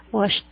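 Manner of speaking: "Washed" as one syllable, washt; "Washed" with a clear final t. Shown as a demonstration. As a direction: end "Washed" on sh plus a released t. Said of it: "suena también como una T".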